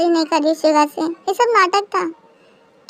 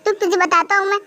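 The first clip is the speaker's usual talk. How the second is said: ordinary talk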